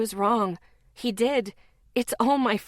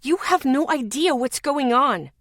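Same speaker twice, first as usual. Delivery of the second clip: raised, louder outburst; shouted; 5 semitones higher, 4 dB louder than usual. Acted. Lexi shouts at Zeke while hitting his arm, louder. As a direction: shout it at him in frustration. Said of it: "I shouted".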